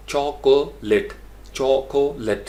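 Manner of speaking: चॉकोलेट as CHAW... ko... let; slow syllable-by-syllable demo of चॉकोलेट; three slow syllables. Shown as a demonstration. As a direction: sound out चॉकोलेट with short "let". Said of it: "इसको हम पढ़ते हैं चॉक".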